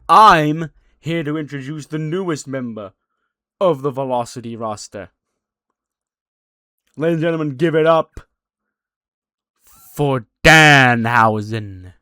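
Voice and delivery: loud, slow announcer build-up; ring announcer. Retold or imitated. Announcer introducing the new signing, loud and drawn out. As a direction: stretch out Danhausen like a ring introduction.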